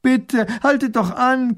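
breathless, high, drawn-out begging; panting plea. A narrator's reading. Fisherwoman begs a departing coach to stop, panting. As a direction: gasp it out, high and urgent. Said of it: "keucht sie".

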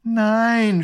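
long, high, loud groan. A narrator's reading. Grand Duke answering the soldier, groaning. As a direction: groan in exasperation. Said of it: "stöhnt der kleine Großherzog".

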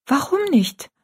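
put-on high, puzzled girl's question; confused young daughter. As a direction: ask it like a bewildered child. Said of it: "fragte Rosalie verwirrt".

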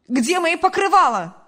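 high, loud demanding yell; loud, high accusing cry; angry mother's shout. A narrator's reading. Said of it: "закричала мать".

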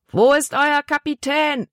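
high-pitched, restrained roar. A narrator's reading. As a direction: bellow the demand up at the boy. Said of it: "brüllte der große Mann". (gebrüllt)

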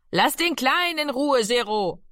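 put-on mother calls out to her older son to leave the little one alone; calling out. A narrator's reading. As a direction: call across the room to stop him. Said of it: "rief Mami".

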